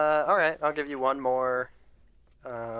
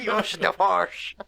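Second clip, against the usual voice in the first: Yoda voice; 6 semitones higher, 6 dB louder, slower than usual. Retold or imitated. Echoing the Yoda line.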